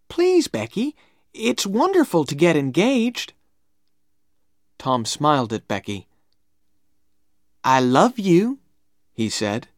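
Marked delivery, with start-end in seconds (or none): 0.1-3.3 high, eager plea; boyish pleading. A narrator's reading. Tom pleads with Becky. 7.6-8.6 higher, louder declaration of love; boyish love declaration. A narrator's reading. Tom declares love to Becky.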